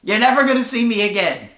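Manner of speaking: high-pitched indignant quitting outburst; acted-out blow-up; retold angry yell. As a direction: storm out with a high, mock-furious parting shot. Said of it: "he just starts yelling at the guy".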